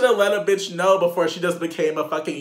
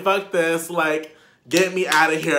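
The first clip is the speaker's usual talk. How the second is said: loud reenacted outburst, a little raised in pitch; worked-up retold demand, louder than usual; retold yelling; 4 semitones higher, 6 dB louder than usual. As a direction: act out yelling to be let out. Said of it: "I was yelling at him".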